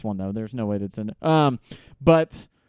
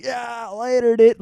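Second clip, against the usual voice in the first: surfer dude voice; 8 semitones higher, slower than usual. Retold or imitated. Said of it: "they're like".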